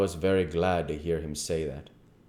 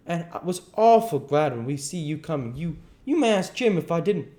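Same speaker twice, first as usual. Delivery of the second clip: higher-pitched, pleading account; frightened boy explaining himself; 9 semitones higher, 4 dB louder than usual. Acted. Boy excusing his escape to the king, earnest pleading. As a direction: plead your case in a high, earnest voice.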